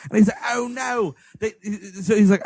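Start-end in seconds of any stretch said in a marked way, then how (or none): 0.5-1.1 higher, mock uber British accent; Johnny Lee Miller. Imitating the actor's real talk, uber British. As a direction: exaggerate the British vowels. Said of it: "uber British he's ever sounded".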